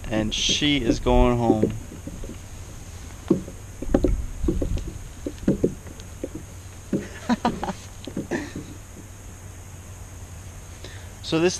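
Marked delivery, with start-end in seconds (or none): 1.8-8.8 high, softer, breathy laughing; real lingering laughter over a great catch; gleeful laugh. Real, not acted. Laughing after measuring the catch.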